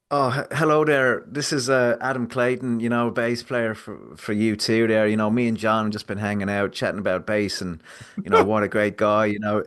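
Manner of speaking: prank-call a friend's wife as a rock bassist in a hammed-up Irish accent; mock Irish accent. Prank caller posing as a U2 bassist to his friend's wife, in a mock Irish accent. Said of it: "what's with the Irish accent".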